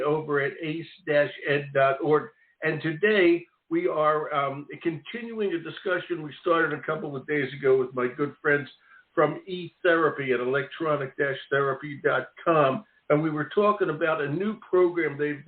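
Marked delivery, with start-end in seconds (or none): none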